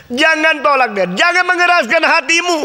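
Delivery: "Jangan tolak dia" high and loud, preaching hard; fervent loud plea. Shown as a demonstration. Preacher urging the congregation, a loud fervent plea. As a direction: cry it out to the church.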